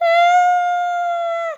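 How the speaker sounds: long, high sustained scream; panicked scream. Pretend. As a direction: hold a play scream. Danger in game. (uzun tiz çığlık)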